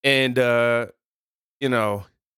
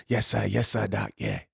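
low preacher's affirmations; church voice; 5 semitones lower, 4 dB quieter than usual. Pretend. Pastor agreeing with his congregation, church voice. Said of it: "Going to my church voice".

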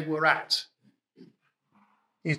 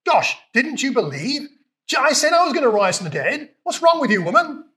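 higher, scolding, exasperated Jesus; mock harsh rebuke; 7 semitones higher than usual. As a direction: scold her like an impatient teacher. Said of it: "It's not like".

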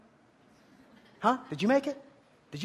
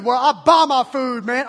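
louder, slow smug dude; 2 semitones higher, 9 dB louder, slower than usual. Retold or imitated. Preacher mocks student, louder slow smug reply. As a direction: drawl it like a know-it-all.

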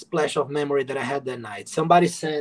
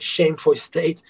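ordinary talk

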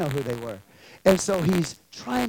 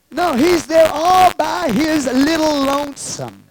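loud, slow, raised-pitch declaration; emphatic preaching; 8 semitones higher, 9 dB louder, slower than usual. Real, not acted. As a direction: drive it home, drawing it out. Preacher stresses Stephen stands alone.

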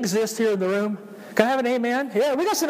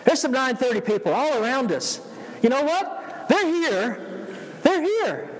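high, excited preacher; 7 semitones higher than usual. Real, not acted. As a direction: cheer them on with a lift. Pastor excitedly greets returning members.

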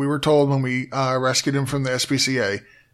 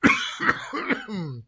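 choking cough; 9 semitones higher than usual. Real, not acted. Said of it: "that went down the wrong hole".

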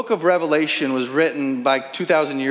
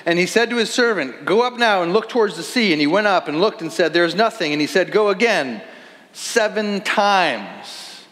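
scripture reading; 5 semitones higher than usual. Acted. Preacher reads scripture aloud.

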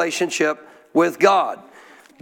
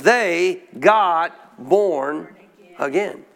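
very slow, word by word, dropping lower; drawn-out emphasis; 3 semitones lower, much slower than usual. Real, not acted. Preacher revealing what happened to the disciples, drawn-out emphasis. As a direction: stretch out GOT and BORN with pauses between. Preacher drives home the climactic point.

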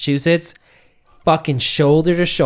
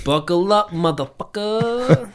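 higher, slow Texan pilot voice; Texan pilot drawl; 9 semitones higher than usual. Retold or imitated. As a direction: stretch it out like a cocky Texan captain. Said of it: "he was some Texan dude".

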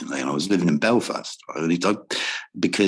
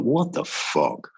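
slow, stretched and raised; drawn-out disbelief; 6 semitones higher, much slower than usual. Retold or imitated. Musicians' reaction to the border risk, slow drawn-out disbelief. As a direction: drag each word out, incredulous.

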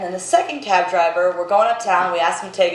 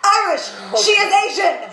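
hammed-up ranting driver, much higher; quoting the cab driver; 10 semitones higher than usual. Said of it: "He's like".

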